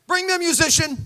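a quick, loud order; commanding call. Retold or imitated. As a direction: call for the band with a finger snap. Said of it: "snapped his fingers like".

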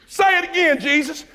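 high, excited crowd call; high, cheering call to Jesus. Retold or imitated. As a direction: egg him on like a fan in the crowd.

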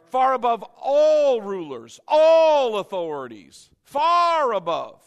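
loud, emphatic preaching; full-force preacher's proclamation; fiery preaching. Acted. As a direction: proclaim it to the congregation.

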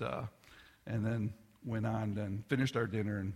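ordinary talk

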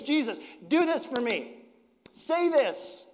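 high, loud, in-your-face command; bold demand. Retold or imitated. Pushy mother demanding favors from Jesus, loud and bossy. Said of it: "she just, like, charges right in and she goes".

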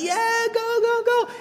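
high excited cheer; cheering a little kid. Retold or imitated. Parent cheering on a small child running a race, high excited cheer. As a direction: call it out like a parent at the finish line.

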